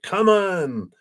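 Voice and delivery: high, drawn-out rallying call. Retold or imitated. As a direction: call a whole class to follow you. Bursts into classroom, calls students.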